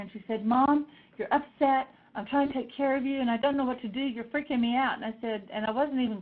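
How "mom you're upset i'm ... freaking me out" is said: higher-pitched, retold exasperated protest; upset. Daughter driving her upset mom, higher upset protest. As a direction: plead in a worried, raised voice, lightly acted. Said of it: "Lauren got upset".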